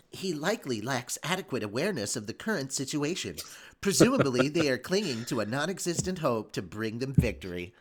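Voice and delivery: cool, level assistant voice; calm AI voice. Acted. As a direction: speak like a detached machine mind. Voices Raphael, the AI.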